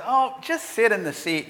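mock "oh, come on" tone; retold pleading. The guy's wife steps into the seat dispute, retold pleading.

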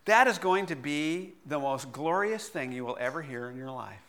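usual speaking voice

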